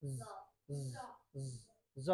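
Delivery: জ said as a drawn buzzing z each time; repeated z sound. Shown as a demonstration. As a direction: repeat জ as a voiced z. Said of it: "এস এর উচ্চারণ হবে".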